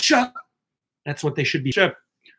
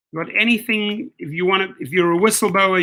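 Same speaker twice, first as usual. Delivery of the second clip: normal talking voice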